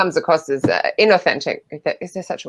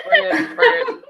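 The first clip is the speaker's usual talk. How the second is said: laughing; much slower than usual. Real, not acted. Laughing, high pitch.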